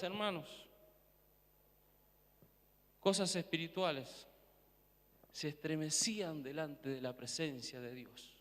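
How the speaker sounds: soft, reverent hush. Real, not acted. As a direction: drop to a hushed awe. Sudden hushed awe after loud preaching.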